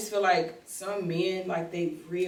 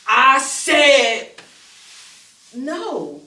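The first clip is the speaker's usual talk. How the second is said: loud, drawn-out higher refusal; firm refusal; 7 semitones higher, 14 dB louder, much slower than usual. Acted. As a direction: belt it out to shut someone down. Acted firm refusal to man.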